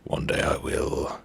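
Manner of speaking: soft breathy promise under the breath; a hushed dramatic vow; whispered vow. Pretend. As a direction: whisper it like a secret oath. Vowing in a whisper.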